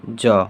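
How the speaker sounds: जौ said clearly and low; letter sound demo. Shown as a demonstration. Teacher modelling जौ for students, clear and low. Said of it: "इसका उच्चारण क्या हो जाता है".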